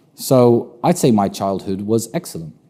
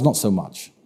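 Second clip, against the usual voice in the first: ordinary delivery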